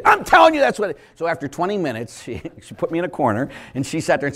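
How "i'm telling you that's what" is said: high, loud mock insistence; retold heated insistence. Woman arguing with the priest, loudly insistent. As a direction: insist over him, pitched right up.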